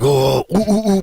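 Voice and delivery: high hooting gorilla noises while the clubs get pounded; gorilla hoots. Retold or imitated. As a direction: hoot like an excited ape. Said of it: "and the gorilla's like".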